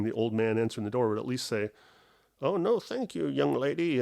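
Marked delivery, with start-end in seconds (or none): 2.4-4.0 lightly voiced old man, pitched up; a hint of a courteous old gent declining; polite old man. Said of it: "I get this old man voice".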